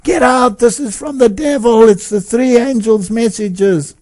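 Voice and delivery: high mock yell of get out; shouting. Man in the aisles shouting at the people to get out, high yell. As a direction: chase everyone out of the hall, yelling. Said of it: "he shouted at the people".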